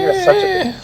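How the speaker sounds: high, tearful, pained insult; crying. Real, not acted. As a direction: whimper it out through tears. Repeats, crying, in pain.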